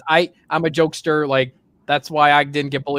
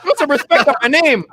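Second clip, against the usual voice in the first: high-pitched, put-on outrage; playfully affronted, pitched up; mock indignant comeback; 8 semitones higher, 3 dB louder than usual. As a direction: act out a cocky retort to an insult. Retelling his comeback to insults.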